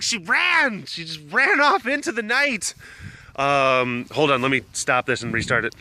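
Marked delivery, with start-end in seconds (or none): 0.0-2.7 high, frantic mock wail; imitated yelling. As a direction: wail it, mimicking a panicked report. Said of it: "just started yelling".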